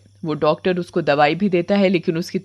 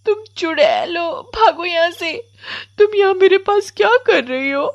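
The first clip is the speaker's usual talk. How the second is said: put-on high-pitched scared little girl; frightened child; 11 semitones higher than usual. Komal orders the witch away from her, frightened. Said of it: "कोमल चुड़ैल को देखकर और डर जाती है".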